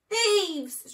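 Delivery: high, loud furious shriek. A narrator's reading. Rat shrieking after the fleeing thieves, loud and high. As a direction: scream it after them. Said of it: "shrieked Rat".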